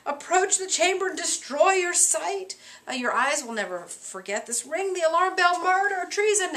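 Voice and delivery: high, overwrought cries of murder; very dramatic Macduff. Retold or imitated. Teacher acting out Macduff raising the alarm, hammed-up dramatic. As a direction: ham up the alarm like a tragic hero. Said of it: "he's very dramatic".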